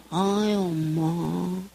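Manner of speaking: low, drawn-out sigh of a word; deflated. Retold or imitated. Third child coming in to mom, deflated. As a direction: sag wearily, dragging it out. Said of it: "푹 풀죠".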